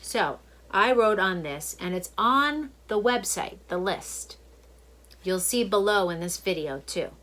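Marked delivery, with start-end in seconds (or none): none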